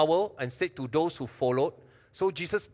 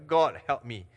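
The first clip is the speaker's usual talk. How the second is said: brief, firm prayer without the wail; short prayer; 6 dB louder than usual. Shown as a demonstration. Preacher shows congregation a prayer not too long, kept short. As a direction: say it short, a bit louder, unraised pitch. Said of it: "you don't pray too long".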